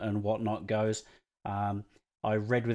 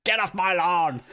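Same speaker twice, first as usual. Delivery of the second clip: much higher, hammed-up cranky old man scolding; grumpy old man; 8 semitones higher, 4 dB louder than usual. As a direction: shoo the kids off the lawn like a crank.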